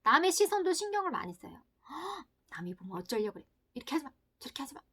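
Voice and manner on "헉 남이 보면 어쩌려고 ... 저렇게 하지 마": fast, hushed, anxious warnings; a gasp, then hurried nervous nagging; anxious fretting. Acted. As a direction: rush it like a worried mother. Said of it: "남을 굉장히 신경 쓰는".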